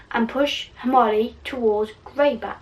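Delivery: plain reading voice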